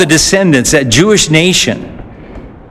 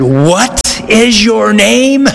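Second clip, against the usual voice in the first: slow, drawn-out, higher and looming; menacing demand; 6 semitones higher, much slower than usual. Acted. Alexander demanding the soldier's name a third time, slow and menacing. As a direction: lean on every word like a final warning.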